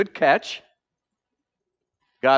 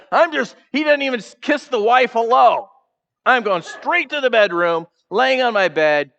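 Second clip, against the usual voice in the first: higher, louder hammed-up sulk; sulking Ahab; 7 semitones higher, 5 dB louder than usual. Ahab refusing to greet his wife, hammed-up sulking. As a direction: play the pouting king stomping off. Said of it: "He's just like".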